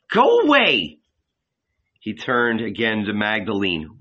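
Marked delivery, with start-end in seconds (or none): 0.1-1.0 sharp, high, loud "Go away!"; shouted order. A narrator's reading. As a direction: drive her off with a high yell.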